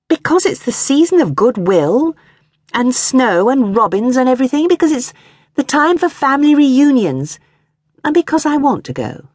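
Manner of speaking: a wife pleading with her husband to go home for Christmas. A narrator's reading. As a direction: beg him and trail off lamely. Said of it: "she finished lamely".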